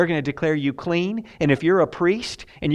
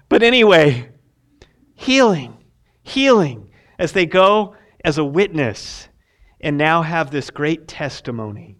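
ordinary talk

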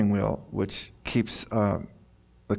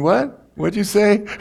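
mock startled God; 10 semitones higher, 4 dB louder than usual. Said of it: "He's going".

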